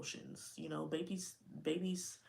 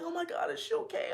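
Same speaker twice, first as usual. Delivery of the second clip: panicked mom; 14 semitones higher, 5 dB louder than usual. Retold or imitated. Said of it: "I'm like".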